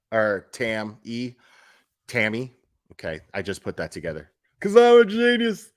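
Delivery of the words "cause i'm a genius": high and loud, hammed-up self-praise; mock boast. To viewers, jokingly boasting about being a genius. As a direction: crow it like a fake genius.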